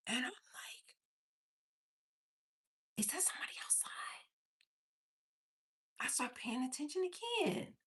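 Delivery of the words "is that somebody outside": whispered, very soft retold question; hushed wondering. Mother hearing a strange voice, hushed wondering. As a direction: whisper it quickly to yourself. Puzzled by a distant voice.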